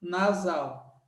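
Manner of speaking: normal talking voice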